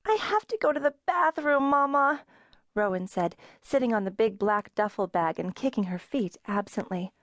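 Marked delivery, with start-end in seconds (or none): 0.1-2.2 put-on child voice. A narrator's reading. Six-year-old Rowan needs the bathroom.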